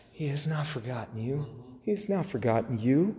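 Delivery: soft, hushed comfort; quietly consoling; gentle reassurance. Real, not acted. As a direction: drop to a tender, quiet promise. Preacher gently reassures the congregation.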